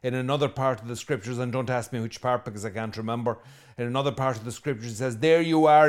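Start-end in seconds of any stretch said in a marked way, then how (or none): 5.2-5.9 louder, mocking exclamation; sarcastic outburst. Jesus scorning those who build tombs, loud and mocking. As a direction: throw it at them with scorn.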